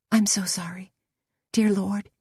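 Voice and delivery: breathless whispered plea for forgiveness; whispered prayer. A narrator's reading. Lydia whispers a prayer of sorrow to God, hushed.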